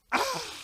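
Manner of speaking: high, loud panicked shriek; scream. Acted.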